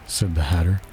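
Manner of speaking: usual speaking voice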